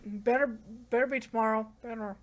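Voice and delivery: ordinary delivery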